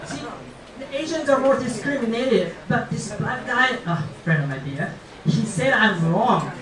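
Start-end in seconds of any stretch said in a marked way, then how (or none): none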